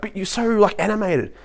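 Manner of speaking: high-pitched imitation of doubting doctors; incredulous quoted reaction; mock disbelief.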